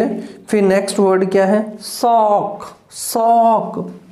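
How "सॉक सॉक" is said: teacher modelling सॉक syllable by syllable; slow drawn-out sock. Shown as a demonstration. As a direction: stretch सॉक slowly, the ओ glide drawn out. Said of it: "ओ diphthong है".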